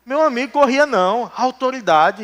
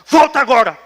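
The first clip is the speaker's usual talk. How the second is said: mock shout; 4 semitones higher, 5 dB louder than usual.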